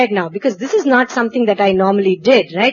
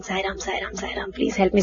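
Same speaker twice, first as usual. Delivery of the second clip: hushed prayer; 3 semitones lower, 10 dB quieter than usual. Retold or imitated. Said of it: "I'm crying by this time".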